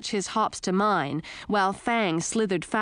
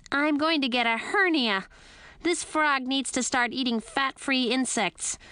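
higher squeaky voice under strain; straining bat voice; 6 semitones higher than usual. A narrator's reading. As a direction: complain while lifting, squeaky. Lil straining to carry Edric.

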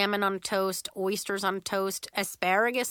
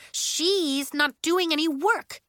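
slow, higher-pitched accusation; Nori, politeness dropped; 7 semitones higher, 4 dB louder, slower than usual. A narrator's reading. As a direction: complain bluntly, dragging out the blame. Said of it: "Nori dropped the politeness".

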